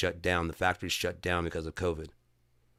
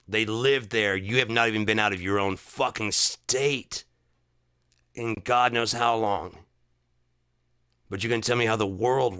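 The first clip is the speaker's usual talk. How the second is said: heated rant; 3 semitones higher, 4 dB louder than usual. Real, not acted. Ranting at friends.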